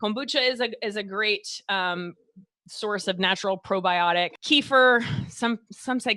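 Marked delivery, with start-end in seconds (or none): 4.4-5.0 "Kefir" said as KEE-fer; long ee in the first syllable of "Kefir"; Texas-style kefir. Real, not acted. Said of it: "but my Texas accent, I'm calling it kefir".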